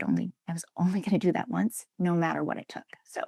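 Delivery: fades into a hushed whisper. Real, not acted. Telling the host how she kept her promise, whispered. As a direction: breathe the words out, almost to herself.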